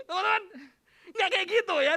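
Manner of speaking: loud, high, laughing correction; laughing protest. Real, not acted. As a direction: call out through real laughter. Recovering from laughing at joke.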